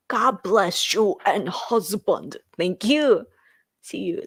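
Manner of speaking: normal talking voice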